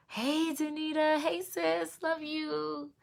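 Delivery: high, tender hello to a friend; lightly voiced loving mother; sweet greeting. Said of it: "When her mom came in".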